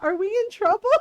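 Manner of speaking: put-on high, loud worried kid voice; mock frightened little brother; scared kid brother. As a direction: plead like a kid who thinks he's in trouble.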